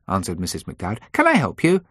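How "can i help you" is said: high, louder switchboard greeting; receptionist on the phone. A narrator's reading. Secretary picking up the firm's line, receptionist voice. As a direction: answer the office line in a lifted voice.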